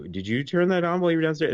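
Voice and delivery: puzzled dad. Retold or imitated. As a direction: ask like a baffled father who just woke. Dad questions family at night.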